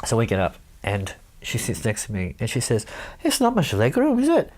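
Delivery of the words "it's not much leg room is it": high, lilting Welsh voice; a light Welsh accent. Retold or imitated. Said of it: "your Welsh accent is better than mine".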